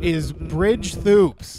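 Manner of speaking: slow, loud dramatic announcement; dramatic reveal. Acted. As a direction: drag out the winner's name loudly.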